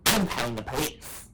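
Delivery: put-on voice mocking an old driver; imitating the other driver. Old driver threatening after the crash, mocking put-on voice. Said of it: "He's like".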